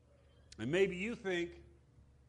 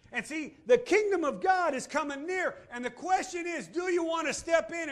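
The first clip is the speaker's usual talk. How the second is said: preaching at full force; 9 semitones higher, 7 dB louder than usual. Real, not acted. Preaching climax.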